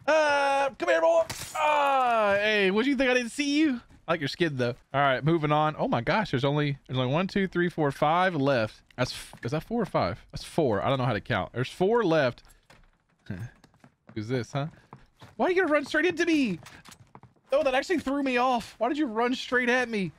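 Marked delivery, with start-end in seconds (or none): 0.1-3.8 high-pitched gleeful taunting; excited taunt. Real, not acted. Hunter catching a hiding player, high taunting cry. 15.4-20.1 high, startled protest; incredulous outcry. Real, not acted. Player rushed head-on by target, high incredulous outcry.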